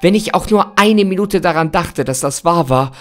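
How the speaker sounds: desperate. Acted. Desperate, pleading over Kimber.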